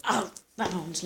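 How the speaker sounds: ordinary delivery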